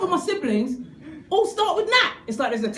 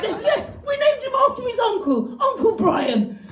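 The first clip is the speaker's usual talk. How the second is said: high, hammed-up proud voice; high mock parental pride; mock proud parents; 9 semitones higher than usual. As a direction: cheerfully announce the name. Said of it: "It's like".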